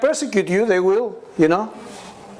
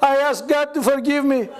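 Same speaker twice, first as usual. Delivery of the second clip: mock tearful plea, higher; imitated crying; 7 semitones higher than usual. Begging Brother Andrew to forgive, imitated tearful voice, higher. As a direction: whimper out the plea, pitched up. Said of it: "And I start crying".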